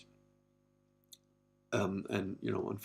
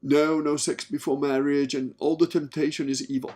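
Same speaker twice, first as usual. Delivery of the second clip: loud, raised preachy voice; mock moralizer; 5 semitones higher, 7 dB louder than usual. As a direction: parody a man sermonizing against temptation. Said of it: "one of these guys that says".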